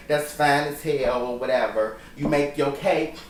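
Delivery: normal talking voice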